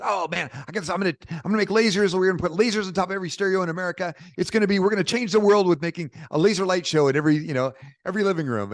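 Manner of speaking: put-on eager kid bragging, rushing the words; excited young self. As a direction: sell your dream breathlessly to a stranger in line. Young self pitching laser dream.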